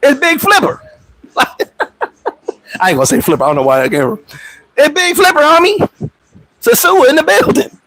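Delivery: big up his rival with a grin; hype greeting. Retold or imitated. Greeting rival Primo in Punta Cana, high hype shoutout. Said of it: "I'm gonna pull up on him".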